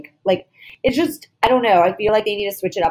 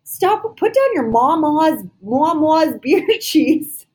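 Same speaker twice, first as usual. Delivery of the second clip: mock scolding; 7 semitones higher than usual. Real, not acted. Hosts scolding a reality star in mock exasperation. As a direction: lecture her like a fed-up parent.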